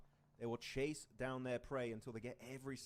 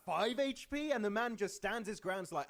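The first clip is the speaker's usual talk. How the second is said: excited; 7 semitones higher, 6 dB louder than usual. Real, not acted. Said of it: "Sorry, I popped off a bit there".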